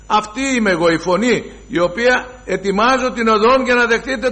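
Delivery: rising, loud prophetic voice; John's proclamation. Acted.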